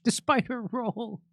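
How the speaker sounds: incredulous, laughing echo; mocking disbelief. Real, not acted. Host repeats the article's phrase back, mocking disbelief.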